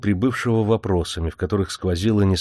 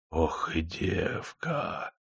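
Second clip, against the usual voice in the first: slow breathy whisper; a man breathing his admiration for a girl; envious sigh; 10 dB quieter, much slower than usual. A narrator's reading. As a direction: sigh it out with longing envy. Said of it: "глубоко вздохнул от зависти".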